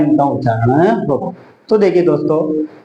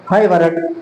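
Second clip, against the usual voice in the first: है वरण run together as hayavarat; all together; 2 semitones higher than usual. Shown as a demonstration. Teacher answering how the fifth sutra sounds, said together. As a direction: blend the five letters into one word. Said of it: "एक साथ बोलेंगे तो क्या कहेंगे इसे?".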